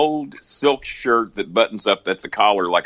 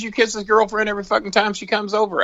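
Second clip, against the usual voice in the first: much higher, incredulous buddy voice; exasperated disbelief; 14 semitones higher, faster than usual. Retold or imitated. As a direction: throw up your hands in disbelief. Sylvester exasperated at Brock's jealousy.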